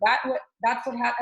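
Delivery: ordinary delivery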